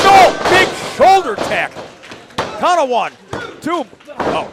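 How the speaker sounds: high, loud near-fall call; excited play-by-play. Real, not acted. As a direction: shout the count as it happens. Calling a near pinfall.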